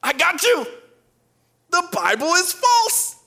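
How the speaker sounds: high, loud triumphant skeptic; hammed-up voice of a gloating critic; mock gotcha. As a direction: crow it like you caught them out. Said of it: "And people say".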